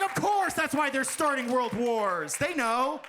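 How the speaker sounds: high, slow, hammed-up cry; mock-indignant conviction; mock revelation. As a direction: proclaim a ridiculous theory at high pitch. Acts out same loud realization.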